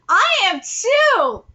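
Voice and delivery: loud, high and drawn out; eager agreement. Acted. Chiming in about being curious, exaggerated and excited. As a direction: pipe up slowly with exaggerated eagerness.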